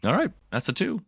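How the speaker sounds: high, casual shrug of a line after the shot; 杀人后轻佻的一句; flippant quip. Retold or imitated. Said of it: "I go".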